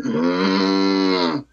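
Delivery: low, drawn-out cow moo; cow impression. Shown as a demonstration. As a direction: moo deep and long like a cow. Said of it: "like a cow impression".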